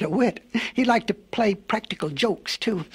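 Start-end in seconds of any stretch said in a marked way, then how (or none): none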